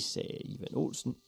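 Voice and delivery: ordinary delivery, nothing marked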